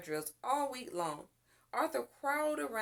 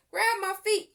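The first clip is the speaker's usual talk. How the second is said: higher, louder urgent command; urgent order; 10 semitones higher, 9 dB louder than usual. A narrator's reading. Boy telling his mother to grab him, urgent. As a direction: shout the plan fast. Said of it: "he told Arthur".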